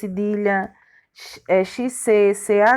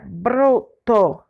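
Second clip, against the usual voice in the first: slow, separated syllables in "broto". Shown as a demonstration. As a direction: break the word into its syllables. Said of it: "essas palavras estão separadas". (sílaba por sílaba, devagar)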